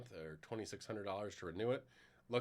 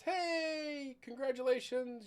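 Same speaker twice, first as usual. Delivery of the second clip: high, sing-song fake cheer; mock cheery greeting; much slower than usual. Cloud service cheerfully greeting the user after re-enabling, high mock cheer. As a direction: chirp it like an overly friendly system message. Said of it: "And then at the first of the month says".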